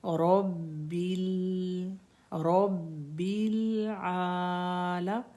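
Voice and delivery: रब्बिल with the final ल stopped still, slow; resting laam. Shown as a demonstration. Teacher showing the sakin laam in रब्बिल. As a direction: close रब्बिल on a calm, still ल. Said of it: "इसको हम सुकून से पढ़ेंगे।".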